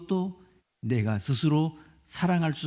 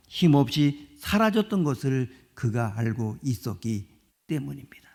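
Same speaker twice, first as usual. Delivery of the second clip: usual speaking voice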